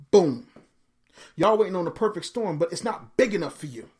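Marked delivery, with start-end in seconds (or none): 0.1-0.5 loud punchline; punchline boom. Real, not acted. Preacher drops the punchline on the audience, loud. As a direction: slam it down.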